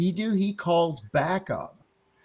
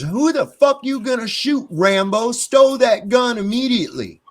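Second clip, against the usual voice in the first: higher, louder furious challenge; angry confrontation; 8 semitones higher, 5 dB louder than usual. Retold or imitated. Father confronting cop in his yard, angry raised shout. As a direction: tell the armed cop off at full voice.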